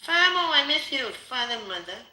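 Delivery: loud high call of longing; anguished cry. Real, not acted. Calling out to her absent parents, loud and high. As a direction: cry out to the parents you miss.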